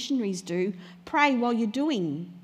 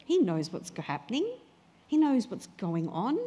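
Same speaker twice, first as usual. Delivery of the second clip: usual speaking voice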